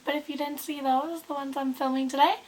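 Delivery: normal talking voice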